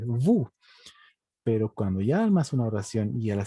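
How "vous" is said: "vous" as a bare "vu"; silent final S. Shown as a demonstration. Teacher repeats the word alone, "vous" with silent S. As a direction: drop the final S of "vous" completely. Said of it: "no se pronuncia la S".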